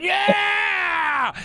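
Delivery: high shouted yeah; crowd yell. Acted. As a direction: yell like a brawling mob. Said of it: "Everybody starts going".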